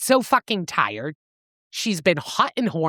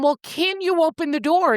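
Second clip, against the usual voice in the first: raised pitch, a request falling at the end; first polite ask; 8 semitones higher than usual. Acted. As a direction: ask him for help, not yet exasperated. First ask, before she gets exasperated.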